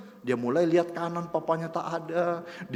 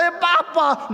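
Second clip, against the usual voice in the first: put-on child's happy surprise; 10 semitones higher, 6 dB louder, slower than usual. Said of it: "dia berkata".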